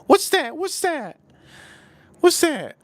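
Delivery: high squeaky mimicry of pestering questions; mimicked questioning friends. Friends puzzled by his six nine, high mimicked questioning. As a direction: ask it repeatedly in a high put-on pitch. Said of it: "They can say".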